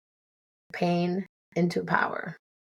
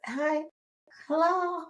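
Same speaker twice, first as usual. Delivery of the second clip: put-on much higher, feeble old-person greeting; mock senior; 11 semitones higher, slower than usual. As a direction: play a worn-out senior saying hello. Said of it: "like senior".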